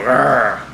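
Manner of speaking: loud playful growl; bear growl. Shown as a demonstration. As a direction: bark out a cheerful bear growl. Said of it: "You gotta go".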